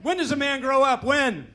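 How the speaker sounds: high, loud rhetorical outcry; mock exasperation. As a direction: throw the question out loudly in exaggerated despair. Mock exasperated plea to audience.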